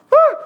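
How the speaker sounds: short loud startled yelp; a frightened burst before running; scared kid yelping before running away; panicked yelp. Retold or imitated.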